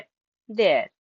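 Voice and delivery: they're said like there, rhyming with care. Shown as a demonstration. Teacher showing they're sounds like care. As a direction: say they're as one syllable like care. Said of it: "They are को हम their जैसा ही पढ़ते हैं".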